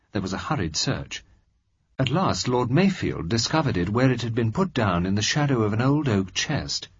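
plain reading voice

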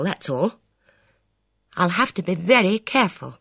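a touch of grim resolve; grim young woman. Acted. Elvira voiced, grim.

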